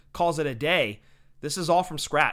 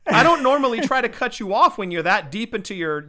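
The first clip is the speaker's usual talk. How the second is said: loud, high and laughing; can't stop laughing, loud and giddy; excited laughing; 5 semitones higher, 7 dB louder than usual. Real, not acted. Cracking up at the joke.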